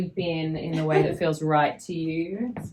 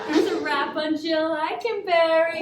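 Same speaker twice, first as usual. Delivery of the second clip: higher and louder, calling it to the room; loud wrap call; 9 semitones higher, 7 dB louder than usual. Real, not acted. Director announcing the wrap to the cast, loud.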